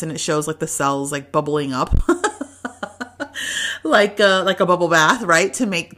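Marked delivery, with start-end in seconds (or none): 2.0-3.8 short high laugh. Real, not acted. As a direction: laugh at the silly image. Laughing at bubbling cells video.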